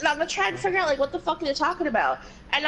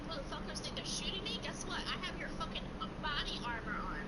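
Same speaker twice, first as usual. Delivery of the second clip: much softer, smug muttering; muttered taunt; 16 dB quieter than usual. Real, not acted. Gamer taunting the players shooting him, muttered. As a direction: gloat quietly to yourself.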